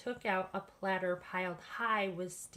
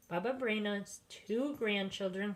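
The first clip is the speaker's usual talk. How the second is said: usual speaking voice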